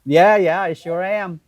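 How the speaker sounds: usual speaking voice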